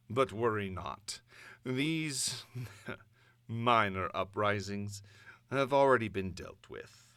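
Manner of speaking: measured, imperious villain monarch address; Doom's broadcast. Acted. Doom addressing the conquered nations by broadcast, imperious and cold. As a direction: proclaim it like a conquering tyrant, cold and grand.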